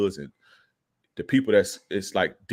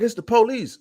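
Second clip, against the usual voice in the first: very high, louder panicked warning; acted alarm; 6 dB louder than usual. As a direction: imitate her alarmed warning.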